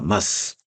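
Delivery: "mas" low, one syllable with a very short s; model reading. Shown as a demonstration. Teacher modelling soukoun for students, low. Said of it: "il faut commencer par l'accent précédent".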